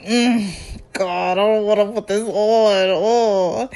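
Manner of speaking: imitated disgusted groaning. Woman handed lingerie by her date, mock disgusted. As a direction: groan through it with mock revulsion. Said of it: "the look of disgust on her face".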